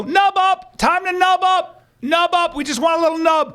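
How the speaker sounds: high-pitched rallying chant; mock battle cry. Body parts rallying for battle, mock battle cry. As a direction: call it out like a comic commander.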